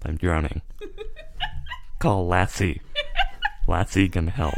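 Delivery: lightly voiced robot calling for help; hollow robot plea from a well; robot trapped in a well. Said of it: "a robot trapped in a well".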